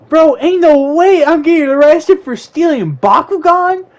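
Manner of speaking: high, loud cry of disbelief; outraged protest. Retold or imitated. Second grader to the arresting cop, outraged protest.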